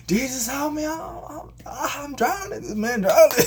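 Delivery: high, loud hammed-up panic; panicked cry for help. Peter sinking and calling Jesus, mock panicked shout. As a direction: yell as if going under, comically frantic.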